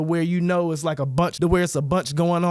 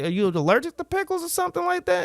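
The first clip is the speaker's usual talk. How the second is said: higher mock chatty stranger; rambling storyteller; 10 semitones higher than usual. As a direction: imitate a storyteller, pitched up and light. Voicing the rambling storyteller's quoted question.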